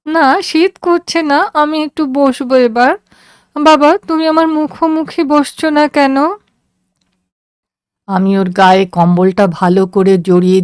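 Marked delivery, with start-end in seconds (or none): 0.1-3.0 put-on high, tired girl's voice; a sick girl gently refusing; young daughter. A narrator's reading. Daughter reassuring her father on the train, high and tired. 3.6-6.2 put-on high, plaintive girl's voice; a sick child wanting her father near; young daughter. A narrator's reading. Daughter asking her father to sit facing her, high and plaintive.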